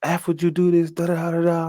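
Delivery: high-pitched, censored mock cursing; high da-da stand-in for swearing; mock cussing. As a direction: retell a cussing protest with nonsense syllables for the swears. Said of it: "like, cussing".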